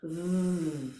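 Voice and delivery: V held long as a buzzing vvv; V said as a long voiced buzz; sustained V. Shown as a demonstration. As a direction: hum the V out on the breath. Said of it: "a V sound as in violin".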